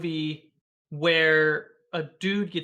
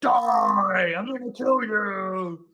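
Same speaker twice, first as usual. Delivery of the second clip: drawn-out, loud movie-demon threat; 4 semitones higher, 4 dB louder, much slower than usual. Retold or imitated. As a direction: growl it out like a horror-film demon. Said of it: "in the demon voice".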